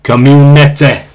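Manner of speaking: slow, broad Yorkshire Community; drawn-out Yorkshire Community, ending in "te"; Yorkshire accent. Shown as a demonstration. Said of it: "That's that Yorkshire accent".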